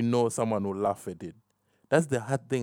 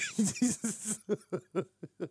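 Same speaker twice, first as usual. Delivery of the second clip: a high, breathy burst of giggling at his own joke; can't hold back a giggle; 9 semitones higher, 7 dB quieter than usual. Real, not acted. Podcast host laughing at his own joke, high giggle. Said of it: "I don't know why I'm laughing".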